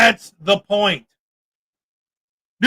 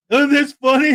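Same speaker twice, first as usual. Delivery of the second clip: mocking laugh; 2 semitones higher than usual. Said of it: "No, that's racist".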